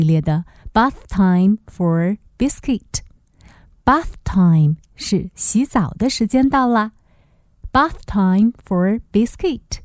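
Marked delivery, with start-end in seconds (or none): none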